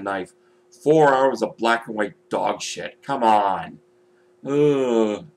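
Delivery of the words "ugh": a loud groan of disgust. Real, not acted. Vlogger recalling a film he hates, disgusted groan. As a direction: groan like the film sickens you.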